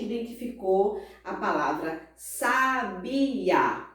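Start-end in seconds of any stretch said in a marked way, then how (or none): 2.2-3.8 "sabiá" stretched as sa-bi-á; syllable by syllable. Shown as a demonstration. Teacher recalls the example word, syllable by syllable. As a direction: say "sabiá" slowly in three syllables. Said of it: "Que a gente separa".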